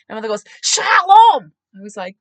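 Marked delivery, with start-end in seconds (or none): 0.6-1.5 high, loud, drawn-out greeting; belted, over-eager farewell; mock hearty call. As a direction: imitate a mother blurting out a greeting. Mother calls out to stranger.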